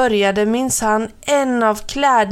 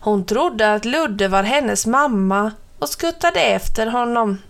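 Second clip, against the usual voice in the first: usual speaking voice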